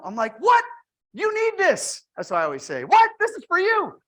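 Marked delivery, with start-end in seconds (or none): 0.4-2.0 mock eager pitch. Salesman acting his eager pitch. 2.8-4.0 mock eager pitch. Acting the pitch line again.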